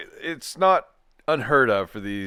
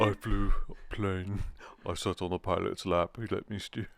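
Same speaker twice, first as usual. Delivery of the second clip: Harry impression; 3 semitones lower, 8 dB quieter than usual. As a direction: draw it out quietly, sending someone up. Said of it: "you're even talking like Harry".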